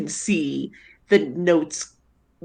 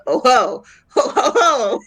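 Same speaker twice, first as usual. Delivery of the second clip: high, gleeful ho-ho laugh; delighted chortle; 7 semitones higher, 5 dB louder than usual. Acted. Zed thrilled by the walking cauldron, gleeful high laugh. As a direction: burst into delighted ho-hos.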